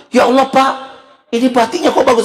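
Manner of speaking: high-pitched gushing wife; delighted admiration. Retold or imitated. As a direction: marvel at an unexpected gift. Wife admires the unwrapped pillow.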